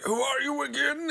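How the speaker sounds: very high, airy dismissal; dismissive snob. Retold or imitated. Britain snubbing Spain in a high sneer. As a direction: pretend you've never met them. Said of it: "he's like".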